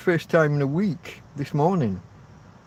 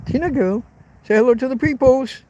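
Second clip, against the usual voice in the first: high-pitched pet voice; talking to the dog; 11 semitones higher, 4 dB louder than usual. Real, not acted. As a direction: coax your dog in a high, lifted voice. Cooing to the dog for viewers.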